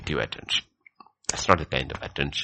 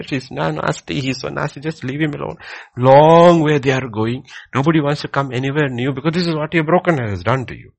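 louder, fast, driving preaching; fervent preaching; 10 semitones higher, 12 dB louder than usual. Real, not acted. Preacher warning the listeners about bitterness, loud and fast. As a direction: hammer it home to the congregation.

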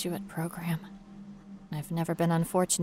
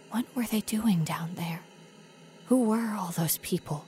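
whisper. Acted. Whispered question.

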